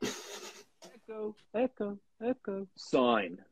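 drawn-out, slow sing-song brand name; slow mock advert chant, laughing into it; mock jingle. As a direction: croon the name like a cheesy jingle. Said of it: "I'm doing an ad for Echo Sign".

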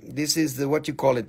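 normal talking voice

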